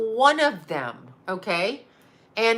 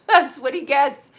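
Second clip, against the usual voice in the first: said through a laugh, higher and louder; 5 semitones higher, 5 dB louder than usual. Real, not acted. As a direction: laugh as you repeat it. Repeats punchline, laughing.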